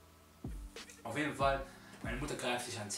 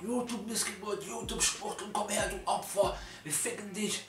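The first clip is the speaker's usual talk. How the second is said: higher, strained mock yell of attackers; imitated shouting; 8 semitones higher than usual. Gang calling out the YouTuber in the park, mock shouting. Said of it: "schreien so laut im Park".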